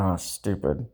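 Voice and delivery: normal talking voice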